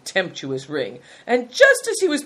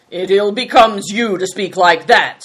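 louder, cold rebuke; icy disapproval, firmly put; cold reproof; 3 semitones higher, 8 dB louder than usual. A narrator's reading. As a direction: rebuke him coldly. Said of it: "said Greencoat coldly".

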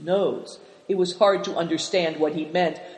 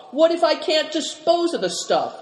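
high-pitched plaintive question; high, worried whine of objection; wailing protest; 10 semitones higher than usual. A narrator's reading. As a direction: keep wailing, pitched right up. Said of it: "wailed Ma".

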